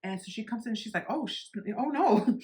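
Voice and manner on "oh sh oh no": raised, alarmed "oh no"; shocked gasp. Retold or imitated. Office manager walking in on the mess, alarmed. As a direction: react to the mess in horror.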